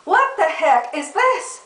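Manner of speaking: high, huffy puppy complaining; indignant puppy voice. Retold or imitated. Puppy Josie scoffing at cat food, high and indignant. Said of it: "She's like".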